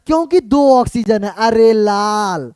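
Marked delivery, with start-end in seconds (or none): 1.4-2.5 slow drawn-out cries. Acted. Teacher drawls playful exclamations.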